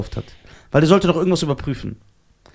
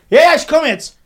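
cocky call; 12 semitones higher, 7 dB louder than usual. Retold or imitated. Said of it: "Und ich so".

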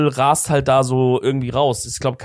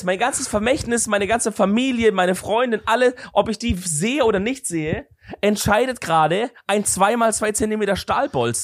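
mock dramatic panic; 7 semitones higher than usual. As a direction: play it as a melodrama of doom. Dramatized fear continues.

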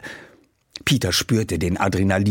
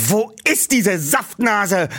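angry; 9 semitones higher, slower than usual. Acted. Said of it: "Aufgebracht starrte er".

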